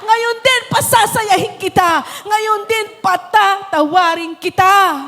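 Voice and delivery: fiery revival preaching; preaching at full force. Acted. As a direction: preach it to the rafters over music.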